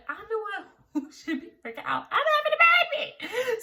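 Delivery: high, laughing exasperation; laughing disbelief. Retold or imitated. Telling her panicking husband she's the one in labor, laughing. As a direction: laugh it out, incredulous. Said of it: "I'm just sitting here like".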